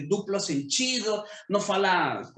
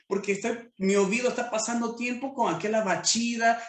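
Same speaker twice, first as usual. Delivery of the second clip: ordinary talk, nothing marked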